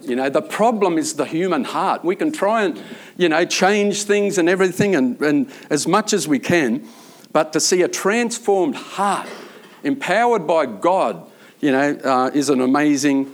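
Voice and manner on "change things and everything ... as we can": normal talking voice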